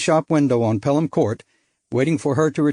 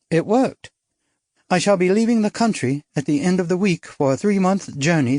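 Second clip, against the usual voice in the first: put-on lighter, higher bright young woman; spirited society girl, higher; young woman character; 6 semitones higher than usual. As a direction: play her as a bold upper-class girl. Narrator voices young woman.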